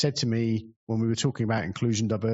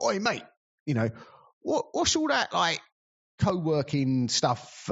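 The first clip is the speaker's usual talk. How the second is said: imitating a lad heckling from the back, much higher pitch; young lad calling out; 5 semitones higher than usual. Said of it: "And he's like".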